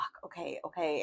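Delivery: taking in a friend's sad news, softly; stunned hush. Retold or imitated. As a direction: let it drop away under your breath. Hearing of a friend's miscarriage.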